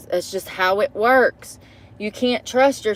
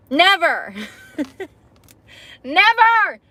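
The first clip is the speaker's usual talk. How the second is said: laughing never; 6 semitones higher, much slower than usual. Real, not acted. Laughing at her own claim.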